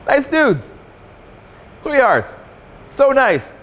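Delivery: high, sing-song voice mocking nice guys; high sweet mocking. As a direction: coo it like talking to a puppy.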